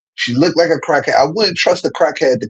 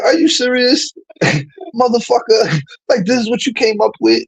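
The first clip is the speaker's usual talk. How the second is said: higher, stunned disbelief, mock outrage; as if you can't believe the nerve; incredulous retort; 7 semitones higher than usual. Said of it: "And I was like".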